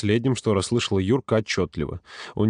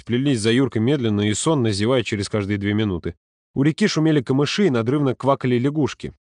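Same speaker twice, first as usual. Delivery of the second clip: ordinary delivery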